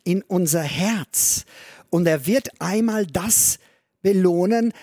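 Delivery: ordinary talk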